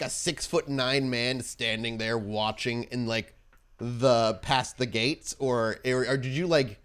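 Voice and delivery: ordinary delivery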